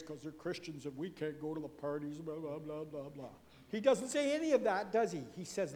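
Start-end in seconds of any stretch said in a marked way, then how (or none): 0.0-3.3 low, droning killjoy mimicry, fading away; long-faced mock gloom. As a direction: mope through it like a joyless churchgoer. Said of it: "With a big long face".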